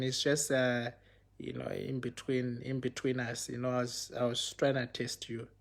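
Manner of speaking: low, hushed retold excuse; secretive retelling. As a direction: play it down softly, between the two of you. Retold urging her to keep secret.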